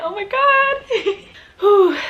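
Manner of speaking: high, slow, tearful laugh-cry. Real, not acted. Recalling a special moment with her best friend, crying. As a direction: let the joy spill into tears. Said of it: "and I'm crying so much now".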